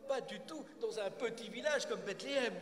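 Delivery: high, loud scoffing disbelief; incredulous interruption. Acted. Nathan interrupting the shepherd's tale, scornfully incredulous. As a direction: scoff loudly at a tall tale. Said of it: "Nathan l'interrompt".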